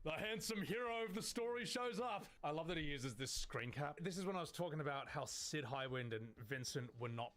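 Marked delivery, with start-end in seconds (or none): 0.0-2.2 high, playful storybook announcement; mock-heroic. Joking at own appearance in the video, mock-heroic. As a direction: announce yourself in a raised, hammed-up voice.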